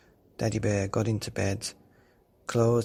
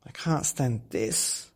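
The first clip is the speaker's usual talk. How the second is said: slow, drawn-out complaint; weary complaint; 2 semitones higher, slower than usual. Acted. Tired Daddy Bear complaining to himself, slow weary voice. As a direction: drag the words, worn out. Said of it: "said Daddy Bear".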